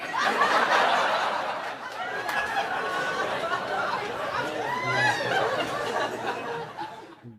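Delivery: long, loud, high burst of laughter; loud cackling laugh at the silliness; big laugh. Real, not acted. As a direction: really let the laugh roll out. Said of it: "Makes me laugh".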